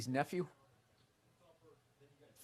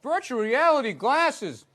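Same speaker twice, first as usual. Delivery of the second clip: mock ad announcer; 9 semitones higher, 10 dB louder, slower than usual.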